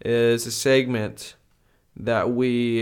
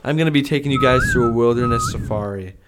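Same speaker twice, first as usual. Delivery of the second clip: peppy orientation leader; 4 semitones higher, 7 dB louder than usual. Acted.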